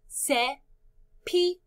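"cepillo" slowly split into ce, pi, llo; syllable by syllable. Shown as a demonstration. Teacher introduces the next word, "cepillo" in slow syllables. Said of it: "Cuántas sílabas en cepillo".

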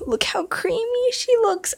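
awed, high whisper. Real, not acted. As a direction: whisper it in awe. Admiring the new horse.

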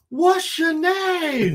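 very high, loud, drawn-out holler; imitated viral-video yell. Co-host imitating a video that goes around, high hollering. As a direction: stretch it out in a high mock shout. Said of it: "what's that video that, that goes around?".

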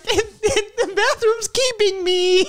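put-on high, slow, laughing child whine; little kid voice. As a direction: mimic a small boy stuck in the bathroom. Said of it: "And he was like".

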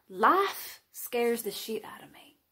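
hushed, nervous whisper; anxious whisper. Acted. Admitting her anxiety to the confessional camera, in a whisper. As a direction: let the fear slip out under your breath.

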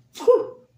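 high, loud spit; spitting sound. Acted. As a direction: blow it out like spitting. Spitting the ant out.

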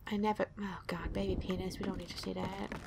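hushed unvoiced whisper; whispered aside to himself. Real, not acted. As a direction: breathe it out in shock. Whispering at something shocking.

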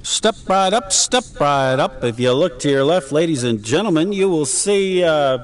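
high carnival barker pitch; snake oil seller. Pretend. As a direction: sell it like a fairground huckster. Playful carnival pitch to co-player.

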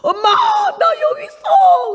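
high, slow crying call of a son; child calling out. Retold or imitated. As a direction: call out to mom like a tearful boy. 꿈속 아들이 부르는 소리. (우는 아들의 높은 부름)

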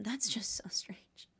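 slow, breathless whisper of disbelief; whispered aside. Acted. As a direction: lean in and whisper it like gossip. Gossip aside, hushed.

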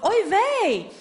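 high, loud put-on oi vey; mock oi vey. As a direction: throw it out like a startled mum. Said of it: "which is Persian for".

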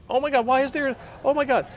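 high loud panicked cries; freaking out, loud and high. Real, not acted. Swarmed by fish.

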